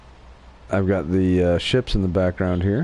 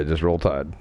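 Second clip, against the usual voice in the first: low, unenthusiastic team slogan; half-hearted cheer; 5 semitones lower, 3 dB quieter than usual. Real, not acted. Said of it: "I'm really saying that half-heartedly".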